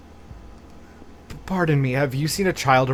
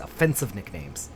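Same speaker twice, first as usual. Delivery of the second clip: ordinary delivery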